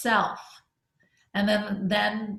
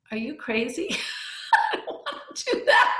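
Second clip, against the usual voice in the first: laughing; 3 semitones higher than usual. Retold or imitated. Retelling reaction to son's request.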